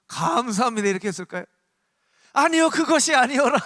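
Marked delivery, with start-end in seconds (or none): 0.1-0.9 higher, louder mock gratitude; courtier's mock thanks. Courtier thanking the king for a death sentence, mock thanks. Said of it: "이러면 그 사람이". 2.3-3.6 loud, high mock panic; courtier's panicked backtracking. Courtier pleading after the king orders death, panicked backtracking. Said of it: "이렇게 하겠죠".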